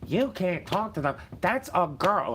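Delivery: shrill it like an overprotective mom; mocking mother. Mocking the mother shielding her daughter, high and loud. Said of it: "their mother was like".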